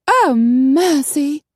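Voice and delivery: high, loud, drawn-out wail; flustered lady. A narrator's reading. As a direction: cry out in fluttery dismay. Coralie panics, fan snapped.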